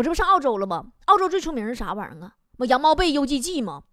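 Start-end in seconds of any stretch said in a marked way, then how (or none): none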